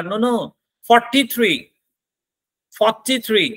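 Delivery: "forty-three" said high, the voice diving down and rising again; correcting fall-rise. Shown as a demonstration. Teacher correcting a wrong age with a high fall-rise dive. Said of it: "falling rising tone लगाओगे".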